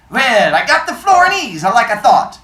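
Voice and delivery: put-on high, loud New York immigrant shopkeeper accent; thick old-world accent. Old bookseller on the phone to a caller, thick New York accent. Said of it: "Pretty good accent".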